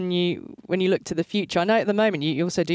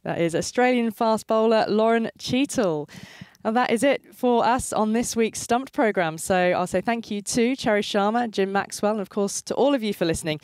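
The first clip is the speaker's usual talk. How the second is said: radio sign-off; 4 semitones higher than usual. Acted. Host signs off programme.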